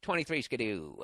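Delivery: a touch of twenties gangster voice; old-timey slang. Retold or imitated. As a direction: toss off a twenties catchphrase. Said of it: "speaking like in film noir".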